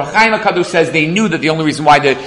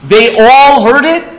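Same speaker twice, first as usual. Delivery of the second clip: incredulous question; 8 semitones higher, 5 dB louder, slower than usual. Acted. Mock question posed to listeners.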